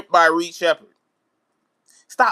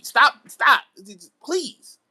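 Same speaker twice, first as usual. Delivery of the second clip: pleading; 5 semitones higher, 3 dB louder, much slower than usual. Real, not acted. Pleading with coach.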